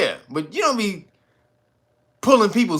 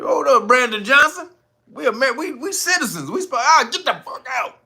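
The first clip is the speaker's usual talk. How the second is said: 1 mock indignant citizen; 8 semitones higher than usual. Mocking citizens at meeting.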